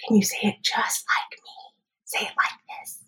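put-on soft therapist whisper to a child. Shown as a demonstration. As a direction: whisper it gently, like coaching. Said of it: "So we'll whisper".

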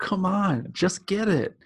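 very high, exasperated yelled plea; mock screaming. Inner voice urging someone to figure it out, mock screaming. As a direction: urge them hard in a high mock yell. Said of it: "is screaming at".

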